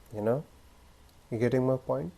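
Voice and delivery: soft check-in after a long silence; deliberate pausing. Shown as a demonstration. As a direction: let silence hang, then ask quietly. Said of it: "this is a pause".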